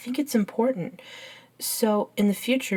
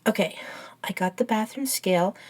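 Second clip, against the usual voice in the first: usual speaking voice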